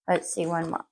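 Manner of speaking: usual speaking voice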